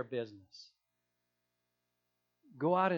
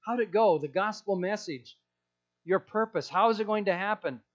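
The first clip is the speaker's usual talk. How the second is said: higher, louder eager questions; excited angels; 5 semitones higher, 6 dB louder than usual. Acted. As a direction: gush like curious angels. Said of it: "excited, inquisitive".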